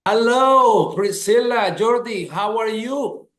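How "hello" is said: ordinary talk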